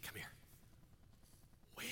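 soft, close; quiet call to win; hushed. Retold or imitated. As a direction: whisper the charge. Hushed echo of the story.

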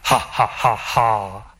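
slow theatrical villain cackle; evil genius laugh. Acted. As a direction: laugh like a mad scientist. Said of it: "cue the evil genius laugh".

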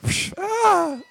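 whoosh, then a high mock cry; imitated sound effects. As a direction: mimic a slap and a high yelp. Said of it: "they did their own effects".

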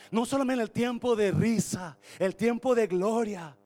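loud, high, driving preaching; preaching at full force. Acted. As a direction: shout the point to the congregation. Predicación a todo volumen. (predicación a gritos)

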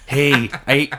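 normal talking voice

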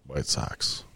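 hushed secret whisper. Real, not acted. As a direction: whisper it so nobody else hears. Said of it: "Why are you whispering?".

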